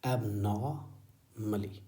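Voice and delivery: abnormally stretched very slowly; very slow teacher demo of abnormally; slow model reading. Shown as a demonstration. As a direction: draw out abnormally for the learners. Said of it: "same style में".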